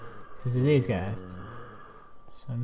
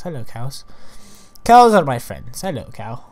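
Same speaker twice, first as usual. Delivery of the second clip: ordinary delivery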